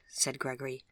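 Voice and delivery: plain reading voice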